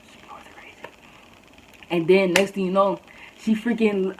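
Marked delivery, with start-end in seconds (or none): 0.0-0.8 barely audible whisper; whispered self-talk. Retold or imitated. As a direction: whisper it to yourself under your breath. Repeats it under the breath.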